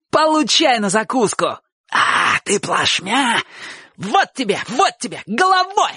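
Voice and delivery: high-pitched bursts between blows; furious fighting cries. A narrator's reading. Boys trade furious words in a brawl, high shouts. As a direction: hurl each taunt as if striking. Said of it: "обмениваясь яростными словами".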